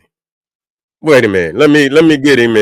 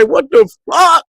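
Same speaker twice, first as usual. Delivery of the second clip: retold outburst; 12 semitones higher than usual. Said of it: "I say".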